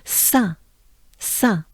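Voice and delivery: "Saint" with a nasal un vowel and no final t; "Saint" sounded through the nose, sa(n). Shown as a demonstration. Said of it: "un, un, un".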